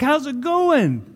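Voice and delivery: high-pitched, excited hello; excited greeting. Acted. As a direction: greet a long-lost friend with a drawn-out lift. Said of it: "So there's excitement".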